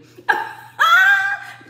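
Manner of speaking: very high, loud lilting laughter; sing-song laugh. Shown as a demonstration. Showing the trick of laughing at Eshu, high lilting laugh. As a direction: cackle brightly like it's all a joke. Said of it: "this is a trick you do".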